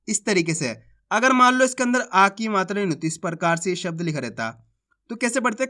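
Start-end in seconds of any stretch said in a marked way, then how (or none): none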